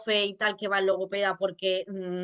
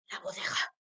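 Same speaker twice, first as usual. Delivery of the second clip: breathy whisper; 7 dB quieter than usual. Shown as a demonstration.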